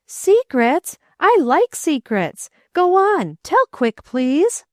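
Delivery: bubbling over to hear a secret; excited boy. A narrator's reading. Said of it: "Wayne was in a quiver of excitement".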